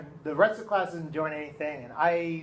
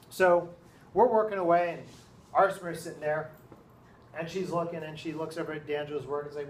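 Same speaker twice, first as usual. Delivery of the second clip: plain reading voice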